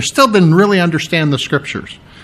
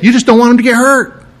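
usual speaking voice

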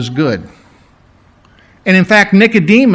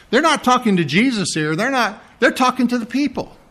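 usual speaking voice

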